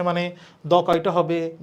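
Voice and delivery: ordinary talk, nothing marked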